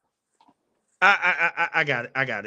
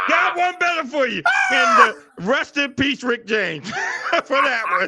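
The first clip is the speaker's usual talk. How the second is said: louder and higher, cracking up; laughing shout; 9 semitones higher, 4 dB louder than usual. Real, not acted.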